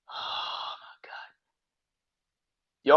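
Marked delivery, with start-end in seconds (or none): 0.1-1.1 breathy, sighing whispered oh my God; soft, slow, defeated whisper; exasperated whisper. Real, not acted. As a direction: let it out in a hopeless whispered sigh. Sighing in disbelief.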